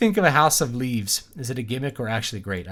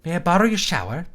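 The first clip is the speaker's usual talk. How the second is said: fake Irish accent; 6 semitones higher, 5 dB louder than usual. Shown as a demonstration. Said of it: "fake Irish accent".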